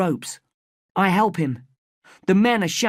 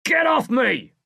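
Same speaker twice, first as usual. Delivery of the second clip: high, raised angry shout; 8 semitones higher, slower than usual. A narrator's reading. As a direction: shove the words out at the boys. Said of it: "shouts the bigger man".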